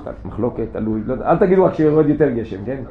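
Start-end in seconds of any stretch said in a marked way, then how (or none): 0.0-1.3 mock mumbled hedging. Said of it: "תמיד תענו הפוך".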